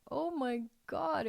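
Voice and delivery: high, drawn-out reliving of being overcome; moved exclamation. Retold or imitated. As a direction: retell it slow and high, touched. Retelling being moved by son's kiss.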